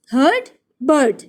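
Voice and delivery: हर्ड and बर्ड with one shared "ur" vowel; matching sounds. Shown as a demonstration. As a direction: say हर्ड, बर्ड as a clear rhyme. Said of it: "sounds किसकी match करती हैं? इन दोनों की". (हर्ड और बर्ड एक ही तुक में)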